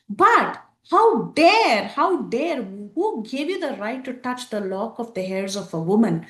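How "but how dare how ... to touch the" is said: outraged rebuke. Retold or imitated. Hanuman rebukes Indrajit.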